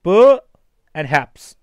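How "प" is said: first syllable punched loud and high; syllable stressed. Shown as a demonstration. Teacher drilling the stressed syllable प loudly. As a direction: hit प hard and loud. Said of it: "ये है".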